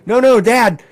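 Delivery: high, loud no no Dad; voicing his son's urgent correction; insistent kid. Son insisting to dad about the roof, high and loud.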